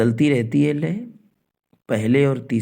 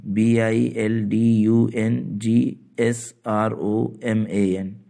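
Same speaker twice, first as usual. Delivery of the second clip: slow letter-by-letter dictation; spelling it out; much slower than usual. Shown as a demonstration. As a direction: spell it out slowly for note-taking.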